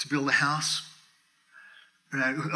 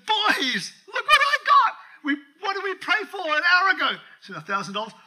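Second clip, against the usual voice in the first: put-on much higher and louder, thrilled shout to the boys; calling the boys, overjoyed and loud; excited shouting; 7 dB louder than usual. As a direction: burst in yelling with joy over the check. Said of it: "I'm, I'm excited".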